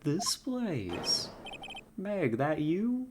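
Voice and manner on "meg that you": put-on worried parent with a southern accent. Caretaker greeting Meg and Keith, southern accent. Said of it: "also got a southern accent".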